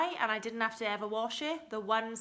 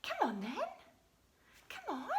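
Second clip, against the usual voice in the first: dog voice; 9 semitones higher, 5 dB quieter than usual. Real, not acted. Coaxing dog to come in.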